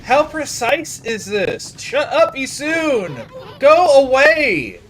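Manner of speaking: high, loud exasperated yelling; real rage building to a drawn-out shout; mad. Real, not acted. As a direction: yell slowly, at the end of your patience. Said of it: "I don't like being mad".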